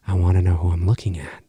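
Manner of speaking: low, menacing whisper; sinister whisper. Retold or imitated. Host imitating Ghostface on the phone, in a low menacing whisper. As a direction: breathe it like a hidden threat. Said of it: "played in that range".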